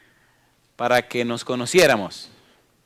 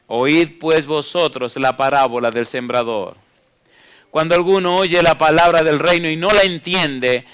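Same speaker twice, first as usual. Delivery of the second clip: preaching the parable; 3 semitones higher, 6 dB louder than usual. Acted. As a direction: proclaim Jesus' words to the congregation. Reads Jesus' words from scripture. (predicación solemne y más fuerte)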